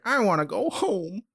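high whiny plea. Retold or imitated. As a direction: whine it like a scared kid. Cole whining to leave.

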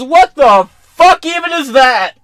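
high and loud baffled shout; hammed-up disgusted yell; mock outraged yell. Host imitating Biden, loud and high disgust. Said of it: "He goes".

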